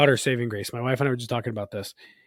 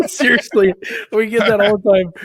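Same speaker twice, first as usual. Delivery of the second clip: laughing; 9 semitones higher, 11 dB louder than usual. Real, not acted. Laughing agreement about kids.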